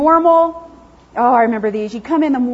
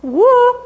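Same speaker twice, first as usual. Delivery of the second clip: high, loud happy whoop; excited dog woo; 11 semitones higher, 5 dB louder than usual. Retold or imitated. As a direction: whoop with the tail wagging. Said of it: "and he'll go".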